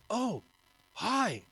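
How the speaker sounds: high-pitched, starstruck little hello; startled greeting. Retold or imitated. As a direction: act caught off guard, voice jumping up. Retells startled meeting with star.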